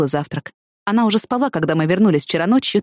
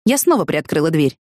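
usual speaking voice

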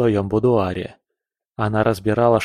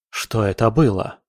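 ordinary delivery, nothing marked

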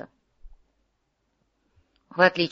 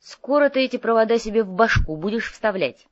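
higher-pitched sarcastic jibe; snide; 5 semitones higher, 4 dB louder than usual. A narrator's reading. Domingo jabs at his computer-mad partner, snidely. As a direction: needle your partner with it. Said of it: "съязвил Доминго".

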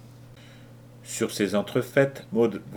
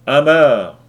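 captain's loud, higher-pitched bellow; shouted order; 4 semitones higher, 14 dB louder than usual. A narrator's reading. Lou Larsen ordering the rope made fast, shouted. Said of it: "Lou Larsen cria".

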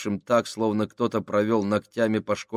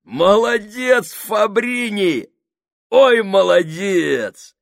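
высоко и медленно, сквозь хохот; тяни слова, захлёбываясь смехом; громко смеясь; 12 semitones higher, 10 dB louder, much slower than usual. Acted. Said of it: "Сакс громко рассмеялся". (Сакс хохочет над Фабрини, громко и медленно)

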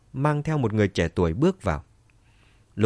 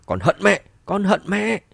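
near-scream rising higher on the repeat; anguished raised cry, pitched high; gần gào lên; 9 semitones higher, 4 dB louder than usual. A narrator's reading. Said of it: "cô gần như là gào lên". (gần như gào lên đầy uất hận)

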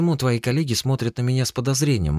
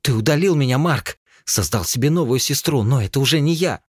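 higher and louder, choked anger; tearful fury; 10 semitones higher, 4 dB louder than usual. Acted. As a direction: rage through tears at her brother. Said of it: "разрыдалась и в бессильной злобе".

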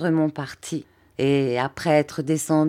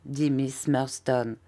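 ordinary delivery, nothing marked

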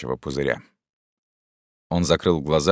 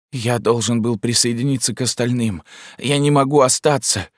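high, tearful whimper, pleading; 7 semitones higher than usual. A narrator's reading. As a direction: whine it out like a wounded man. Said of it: "хныкал закопчённый". (хныкающий голос)

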